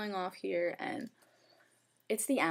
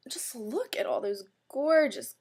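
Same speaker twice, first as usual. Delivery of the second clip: gushing; 4 semitones higher, 3 dB louder, slower than usual. Real, not acted. Gushing over palette colours.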